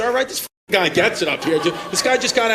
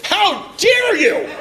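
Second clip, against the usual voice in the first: mock outraged; 12 semitones higher, 4 dB louder, much slower than usual. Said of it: "he goes".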